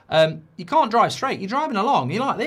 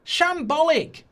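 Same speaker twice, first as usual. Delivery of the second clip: scornful verdict; 9 semitones higher, slower than usual. Real, not acted. One-word verdict.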